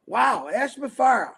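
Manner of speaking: ordinary talk, nothing marked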